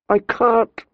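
high, tearful refusal; crying out her helplessness; high weeping protest. A narrator's reading. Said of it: "she wept".

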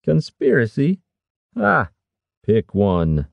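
high incredulous start, dropping to dismissive; scoffing. A narrator's reading. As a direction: scoff at the word, then toss off the rest. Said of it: "Harwick scoffed".

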